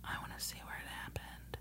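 hushed whisper, no voice. Real, not acted. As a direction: breathe it in a secret whisper. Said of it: "Why are we whispering?".

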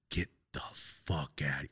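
slow, breathy whispered disbelief; stunned whisper. Retold or imitated. The stunned coworker reacting as he quits, slowly whispering. Boss's jaw dropped, retold reaction.

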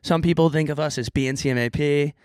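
bright intro announcer read; podcast host intro. Acted.

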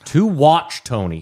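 slow, emphatic correction of the name, To Watch Tony; correcting the name. Real, not acted. Correcting a friend who says the name wrong, slow and emphatic. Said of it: "first of all".

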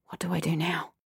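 breathless scared whisper to herself; frightened whisper. A narrator's reading. Girl in the stranger's car, terrified inner whisper. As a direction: whisper it, frozen with fear. Narrator's panicked inner thought.

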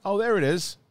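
high, loud mock delight; light up like you just spotted it; delighted discovery. Finding his movie on streaming, mock delight.

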